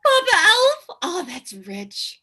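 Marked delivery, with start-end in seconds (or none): 0.0-1.0 loud, high-pitched incredulous echo; high, loud mocking question; mocking disbelief. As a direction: squeal it back in disbelief. Mocking the elf's answer.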